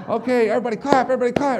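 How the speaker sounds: loud, high call to clap; rally the room like a hype man. Retold or imitated. Warm-up man ordering the crowd to clap, loud and high.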